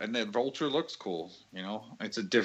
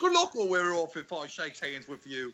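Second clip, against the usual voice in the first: mock Cockney; 7 semitones higher than usual. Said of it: "us just doing really bad Cockney accents".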